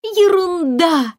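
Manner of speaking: high, slow dismissive exclamation; exclaiming fairy. A narrator's reading. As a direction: brush off the child's fear. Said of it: "воскликнула зубная фея". (восклицание феи)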